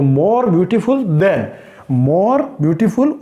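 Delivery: very slow, deliberate repeat; teacher repeating the comparative form slowly; slow emphasis. Shown as a demonstration. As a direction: slowly point out the pattern words. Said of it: "than का use भी किया".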